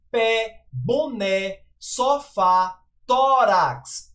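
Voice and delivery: stressed é, á and ó opened wide in "Pé, boné, sofá, tórax"; open vowels. Shown as a demonstration. Teacher showing the acute accent, open vowels in "Pé, boné, sofá, tórax". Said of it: "pronunciada de forma aberta".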